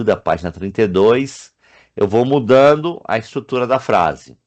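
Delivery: ordinary talk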